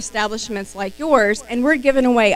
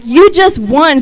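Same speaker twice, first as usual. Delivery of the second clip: louder, higher, excited; excited announcement; 4 semitones higher, 6 dB louder, slower than usual. Retold or imitated. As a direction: announce the win with a burst. Acting the excited caller.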